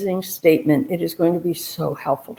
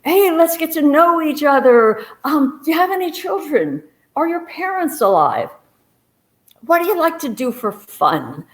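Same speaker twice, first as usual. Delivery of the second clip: nervous chatter; 8 semitones higher than usual. Retold or imitated. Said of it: "So I'm saying anything to her".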